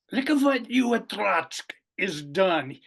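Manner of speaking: high, loud mock Russian accent for a Bolshevik. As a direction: tease like a Russian soldier in a put-on Russian accent. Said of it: "kind of a Russian accent".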